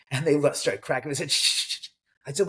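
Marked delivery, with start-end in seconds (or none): 1.3-1.9 hushing shush. Retold or imitated. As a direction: shush them down gently. Hushing guests who started cracking up.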